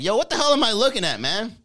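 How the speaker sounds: high, loud, incredulous Miami bro; loud Miami guy. Retold or imitated. Miami guy mocking a European painting, loud and high. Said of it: "We'd have the Miami guy looking at that art piece".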